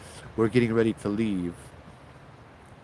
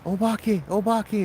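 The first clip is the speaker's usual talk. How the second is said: high frightened calls, rising each time; scared wife yelling in Japanese; panicked yelling; 10 semitones higher, slower than usual. Retold or imitated. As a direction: cry out in terror at the ghosts. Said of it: "she's yelling".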